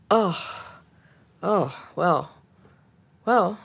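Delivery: imitated deflated sighing, long pauses; spent relief. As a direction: let it out as if emptied of anger, haltingly. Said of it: "she was just at the bottom of it".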